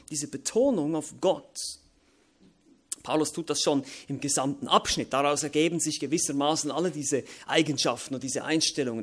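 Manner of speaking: ordinary delivery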